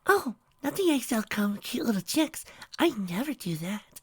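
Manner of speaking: high, gentle pony voice; timid Fluttershy. A narrator's reading. Fluttershy explaining chicks to Trixie, in a gentle high voice.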